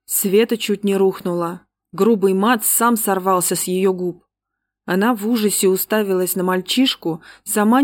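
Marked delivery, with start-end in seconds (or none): none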